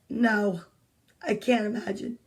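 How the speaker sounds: ordinary delivery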